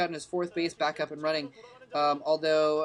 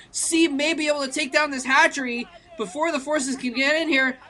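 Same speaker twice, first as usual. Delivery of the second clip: raised, urgent live commentary; keyed-up esports caster; excited play-by-play; 6 semitones higher, 5 dB louder than usual. Real, not acted. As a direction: call the attack as it happens, pitched high and loud. Commentator calls attack on hatchery.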